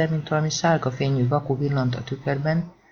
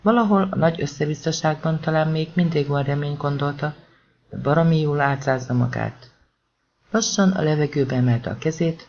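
ordinary delivery

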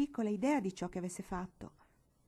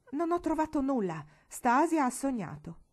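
dismissive grandmother; 6 semitones higher, 6 dB louder than usual. Acted. Grandmother dismisses the lice alarm.

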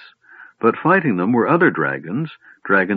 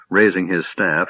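ordinary delivery, nothing marked

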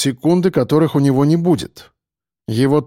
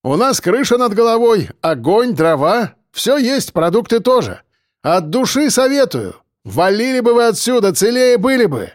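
high, projected boasting; taunting call; 8 semitones higher than usual. Acted. Defender bluffing besiegers about supplies, taunting call. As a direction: call out with bold confidence.

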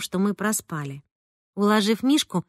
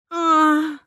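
near tears; 7 semitones higher, 3 dB louder than usual. A narrator's reading. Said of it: "чуть не заплакала мама".

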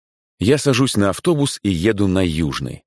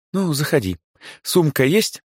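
kindly shop manager, higher; friendly older woman voice; директриса, добрая; 9 semitones higher than usual. A narrator's reading. Director voice. (добродушная директриса)

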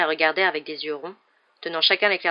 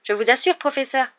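higher, pleading assurance; slightly trembling plea; 8 semitones higher, 5 dB louder than usual. A narrator's reading. Boy assuring professor about broom, slightly trembling. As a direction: insist anxiously, voice pitched up. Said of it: "la voix un peu tremblante".

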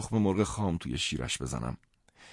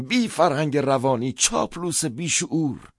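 much higher and louder, slowing at the end; furious shout; 9 semitones higher, 7 dB louder than usual. Retold or imitated. Narrator screaming back at Karl, furious shout. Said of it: "و من فریاد زدم".

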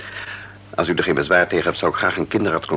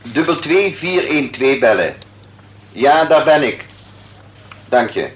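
phone call; 8 semitones higher, 4 dB louder than usual. Acted. Doctor on the phone.

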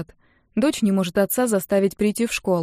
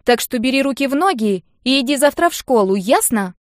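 put-on loud, high bossy girl; insistent command; bossy order; 5 semitones higher, 5 dB louder than usual. A narrator's reading. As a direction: order your dad around. Sister orders her father about.

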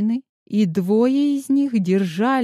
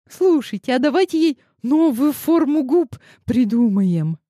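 put-on higher, eager girl voice; excited girl idea; 6 semitones higher, 3 dB louder than usual. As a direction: blurt out the bright idea.

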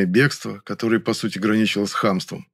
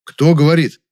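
loud, higher, insistent question; sharp; 6 semitones higher, 9 dB louder than usual. A narrator's reading. Narrator presses Nyura on who said it, sharply. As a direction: demand an answer sharply. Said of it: "резко оборвал".

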